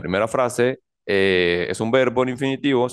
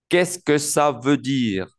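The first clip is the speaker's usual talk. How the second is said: words linked in one measured phrase; careful French model without breaks between words; joined-up model; 3 semitones higher, slower than usual. Shown as a demonstration. Teacher repeats the phrase joined up.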